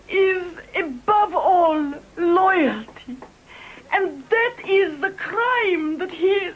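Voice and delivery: choked with feeling about loyalty; emotional break. Acted. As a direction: voice cracking with emotion, slowing. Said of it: "I am emotional".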